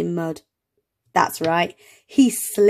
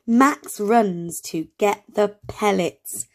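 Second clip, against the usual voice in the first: slow reading aloud; slower than usual. Shown as a demonstration. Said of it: "Shall we say it together".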